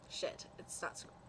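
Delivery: soft breathy aside under the breath; whispered aside. Real, not acted. Reader whispering a curse at his own misread, low. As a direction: curse quietly to yourself.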